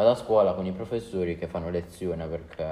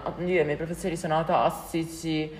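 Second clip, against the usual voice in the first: mocking; 10 semitones higher than usual. Mocking TikTok users again.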